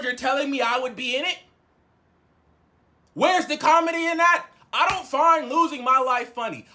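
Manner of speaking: high, indignant retold tirade; acted-out righteous anger; outraged confrontation. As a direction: build the case with hard-hit words. Retold angry confrontation over the joke.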